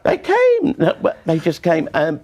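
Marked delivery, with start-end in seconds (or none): 0.0-0.8 squeaky high laugh through the words; real laughter breaking the words. Real, not acted. Laughing while answering back.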